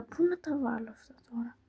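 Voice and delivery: soft unvoiced whisper, puzzled; quiet whispered pondering; whispered question. Real, not acted. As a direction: whisper it to yourself, unsure. Puzzling over where pieces go.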